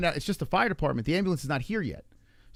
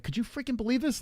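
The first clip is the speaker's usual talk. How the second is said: much higher, softer stunned question; incredulous; 9 semitones higher, 4 dB quieter than usual. Retold or imitated. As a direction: ask it in pure disbelief. To daughter as ambulance passes.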